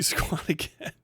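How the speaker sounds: ordinary talk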